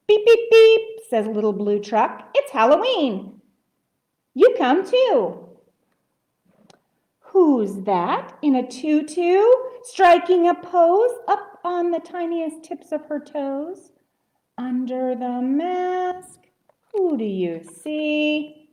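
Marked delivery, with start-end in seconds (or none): 0.1-0.8 loud, high imitated beeps; truck horn beeps. A narrator's reading. 2.3-5.5 high, eager storybook call; excited invitation. A narrator's reading. Voices truck inviting a friend. 7.2-18.7 lilting read slowing to drawn-out sing-song; rhyming story reading. A narrator's reading. Reads rhyming story to children.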